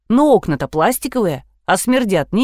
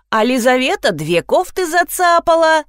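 high, singled-out accusation; tattling boy; 9 semitones higher, 3 dB louder than usual. A narrator's reading. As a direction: tell on her like a little kid. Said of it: "моментально продал девочку Кирюша". (ябедничает высоким голосом)

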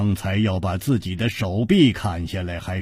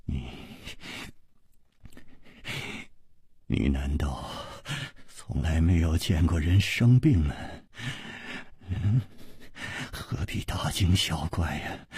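soft, strained words with a forced smile; forced smile through pain; 7 dB quieter than usual. Acted. Chu Liuxiang, sick, reassures his worried friend with a strained smile. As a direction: hide the pain behind a weak smile. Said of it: "咬着牙，却还是勉强地笑着".

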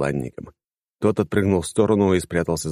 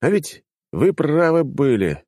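rueful; 10 semitones higher, slower than usual. A narrator's reading. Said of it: "сокрушённо сказал я".

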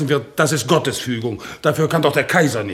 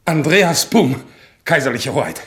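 raised, loud announcement of one's name; military name report; 3 semitones higher, 3 dB louder than usual. Acted. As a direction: report smartly to a superior. Soldier reports name to royalty. (stramme, laute militärische Meldung)

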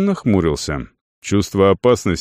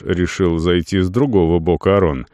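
plain reading voice

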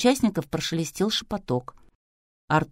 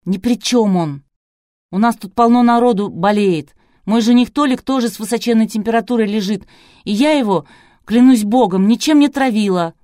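loud, high, fervent protest; heated defence; 6 semitones higher, 8 dB louder than usual. A narrator's reading. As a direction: argue hotly that he is innocent. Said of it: "горячо произнесла". (горячо заступаясь, громко и высоко)

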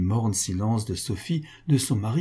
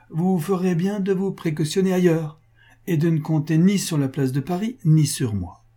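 raised pitch, mounting to a pointed finish; creditor Pascal, cruel dismissal; 5 semitones higher, 3 dB louder than usual. A narrator's reading. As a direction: deliver the crushing refusal with sardonic politeness. Creditor delivers the final blow.

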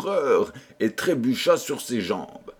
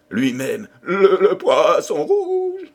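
very high, slow, loud stutter of fright; stutter, then wail the name up high; terrified stammer; 8 semitones higher, 6 dB louder, slower than usual. A narrator's reading. The king naming the one he dreads, stammering with terror. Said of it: "bègue de terreur".

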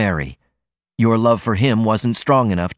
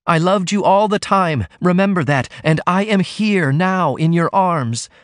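passionate plea; 8 semitones higher than usual. A narrator's reading. Ruth clings to Martin, pleading: higher, passionate, slowing. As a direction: hold onto him with every word.

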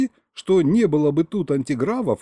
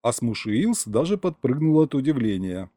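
ordinary delivery, nothing marked

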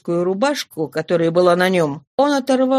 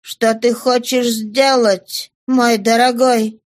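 very slow, word after word; hollow, halting voice; 3 semitones higher, slower than usual. Acted. Mother asks her husband his plan, dull slow voice. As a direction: let each word stick in the throat. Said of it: "спросила глухим голосом".

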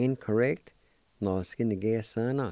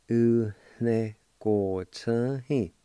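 "U ne ko chan he" spoken slowly, syllables spaced apart; slow careful Cherokee; slower than usual. Shown as a demonstration. Teacher slowly modelling "U ne ko chan he" for the class. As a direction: stretch out "U ne ko chan he" so learners can follow. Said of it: "It's pronounced like this".

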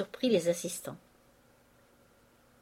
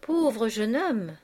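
exclamation; 3 semitones higher, 4 dB louder, much slower than usual. Acted. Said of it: "s'écria Vautrin".

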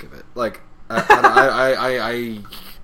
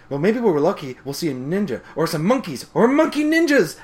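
higher, building to a squeal; Pinkie Pie voice; 6 semitones higher, 5 dB louder than usual. Retold or imitated. Pinkie hoping for monkey ninjas, rising giddy voice. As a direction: get giddier on each idea. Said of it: "Pinkie like".

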